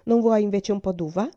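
stern but coaxing, set down the rules; firm aunt. Acted. Aunt trying to calm the girl at night, firm and loud. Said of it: "disse con fermezza".

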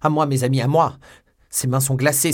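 loud, high shout for help; alarmed cry. A narrator's reading. Young man calling friends to dying beloved, panicked shout. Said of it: "s'écria D'Artagnan".

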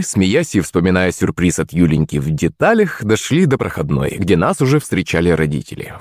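ordinary delivery, nothing marked

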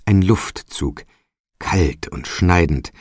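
ordinary delivery

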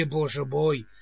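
usual speaking voice

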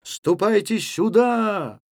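high, slow, drawn-out summons; calling out. A narrator's reading. Левко to the regrouping lads, calling out. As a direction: call them over, holding the last vowel.

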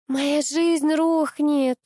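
high, long-drawn whine; whining. A narrator's reading. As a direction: whimper it, stretching every word. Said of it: "захныкала Нина".

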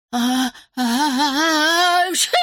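high drawn-out gasps to sneeze; building sneeze. Acted. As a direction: wind up a huge sneeze. Dragon about to sneeze.

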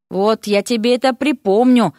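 usual speaking voice

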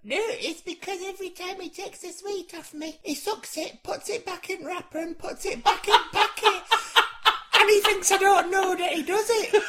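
put-on high, shrill-pitched old grandma; Doreen old lady voice. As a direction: play a nagging grandma, high up. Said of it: "I'm being Doreen now".

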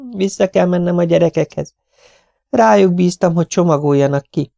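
high, gentle lady's voice; tired young wife. Acted. Mrs Tenius refusing food from Miss Lawson, high and polite. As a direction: decline politely, worn out.

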